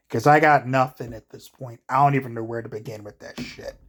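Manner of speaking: ordinary talk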